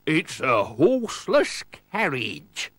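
slow, thundering captain's voice; thundering pirate captain. A narrator's reading. Pirate captain sees the car, slow thundering exclamation. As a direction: thunder it out slowly in character. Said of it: "he thundered".

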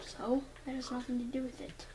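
quiet whispered retort. Real, not acted. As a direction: whisper it aside.

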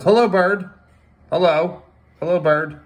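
high, loud greeting call. Real, not acted. Dad talks to the birds, calling out high. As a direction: sing out hello as if to a bird.

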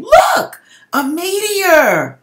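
high loud shout, stretching out meteor; retold yell. Spotting a meteor over the porch, shouting to family. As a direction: call out to the whole porch in alarm. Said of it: "yelled to everyone saying".

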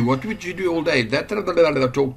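usual speaking voice

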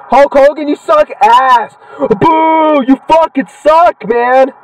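high, slow jeering boos; drawn-out taunting jeers at a game wrestler; mock heckling. Real, not acted. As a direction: heckle the wrestler like a rowdy fan. Trash-talking wrestler in game.

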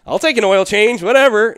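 offhand whatever. Retold or imitated. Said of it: "I'm like".

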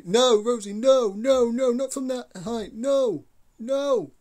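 high, loud desperate no's; panicked shouting. Real, not acted. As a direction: beg him to stop, alarmed. Partner about to jump, panicking.

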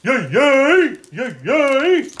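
loud high yay cheers; cheering. Real, not acted. Celebrating being safe.

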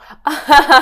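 a sudden high laugh bursting out; burst of laughter. Real, not acted. Telling the story of the kiss, a sudden high real laugh.

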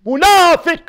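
high drawn-out cry; emphatic condemnation. Real, not acted. Preacher condemning those who hate Abu Hurayra, emphatic cry. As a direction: hammer مُنافق out, high and long.

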